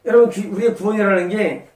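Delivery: loud, rising sermon climax; preaching at full force. Acted. Pastor drives home faith to the church, loud full-force preaching.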